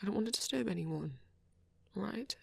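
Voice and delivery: hushed whisper. Real, not acted. Reader tells companion about not disturbing anyone, whispering. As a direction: keep it down so nobody is disturbed. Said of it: "I'm whispering".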